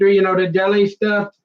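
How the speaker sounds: ordinary delivery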